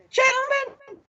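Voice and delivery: cracks way up high on the word; a young officer's voice breaking mid-call; voice cracking. Retold or imitated. Young club officer calling rowdy officers, voice cracks high. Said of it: "and my voice cracked".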